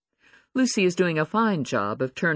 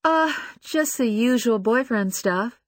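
slow, sheepish and nervous; nervously, higher and slow; 10 semitones higher, 3 dB louder, slower than usual. A narrator's reading. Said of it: "she says nervously, sheepishly".

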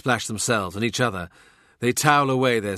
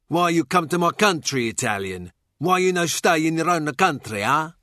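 higher-pitched fool faking broken Italian English; jeering cod-Italian voice, pitched up; mock Italian accent; 6 semitones higher than usual. A narrator's reading. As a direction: put on a taunting Italian accent. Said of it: "he's not an Italian".

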